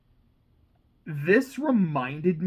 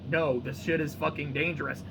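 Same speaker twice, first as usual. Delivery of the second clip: ordinary talk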